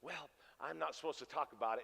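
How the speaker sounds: hushed confiding. Retold or imitated. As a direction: lean in and keep it hushed. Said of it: "she leans in".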